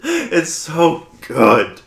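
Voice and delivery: drawn-out, high, loud gush through laughter; laughing delight. Real, not acted. Host relishing a favorite Spike moment, slow laughing gush. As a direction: savor it slowly while laughing.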